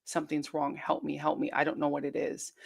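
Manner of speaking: hushed, breathy plea for help; whispered prayer. Retold or imitated. Praying to Jesus for help, in a desperate whisper. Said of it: "I called out to Jesus and I said".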